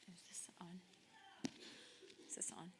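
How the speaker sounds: soft unvoiced whisper; hushed, checking if the mic works. Real, not acted. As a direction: whisper it so the room barely hears.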